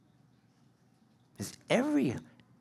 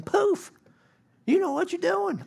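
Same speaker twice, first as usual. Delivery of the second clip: mock magic poof. Mock magic wand transformation.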